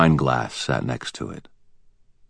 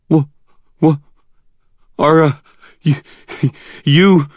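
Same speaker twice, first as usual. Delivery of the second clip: broken, stumbling words, pitched up and loud; terrified stammer; 12 semitones higher, 7 dB louder than usual. Acted. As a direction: stammer it out in terror. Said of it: "I stammered".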